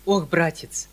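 cry out. A narrator's reading. Uncle cries out to nephew in mild despair. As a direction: wave it away in despair. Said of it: "вскрикнул он".

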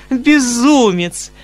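high, slow, coquettish teasing; charmingly playful. Acted. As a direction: tease him like a flirt, drawing it out. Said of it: "с самой очаровательной игривостью".

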